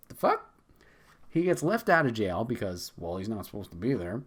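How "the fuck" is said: high, startled what-the; baffled outburst. Retold or imitated. Vulture suddenly in jail, a high startled outburst. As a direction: react like you just appeared somewhere strange. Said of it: "is like".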